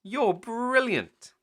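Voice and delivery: high, loud, slow praise; excited child. Acted. Peppa praising Mummy, high and excited. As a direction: gush over Mummy.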